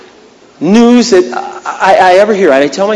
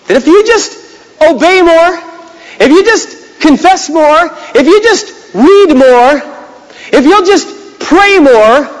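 mocking legalism; 9 semitones higher than usual. Mocks legalistic religion.

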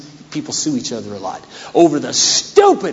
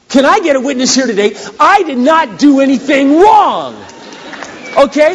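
preaching shout; 11 semitones higher, 7 dB louder than usual. Acted.

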